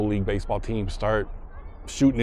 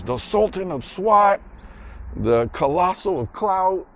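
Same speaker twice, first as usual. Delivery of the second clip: high, slow, drawn-out titles; kids reciting nicknames; 10 semitones higher, slower than usual. Retold or imitated. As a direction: imitate boys proclaiming grand nicknames. Kids chanting Bambino's grand nicknames.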